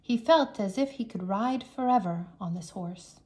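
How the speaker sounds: ordinary delivery, nothing marked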